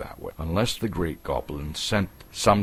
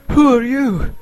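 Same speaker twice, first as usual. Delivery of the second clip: startled; 12 dB louder than usual. A narrator's reading. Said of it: "jumped nearly out of his skin".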